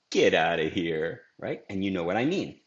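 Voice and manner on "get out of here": low, dismissive get out of here; low scoffing take. Shown as a demonstration. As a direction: say it low and brushing off. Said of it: "If I say, for example".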